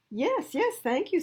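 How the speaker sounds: high, excited little brother; eager little boy. Acted. Little brother eagerly accepts, high and excited. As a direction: squeal a happy yes like a small boy.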